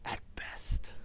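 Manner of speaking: lightly voiced villain, half-whispered; a hint of a British baddie; evil voice. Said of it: "in evil voice".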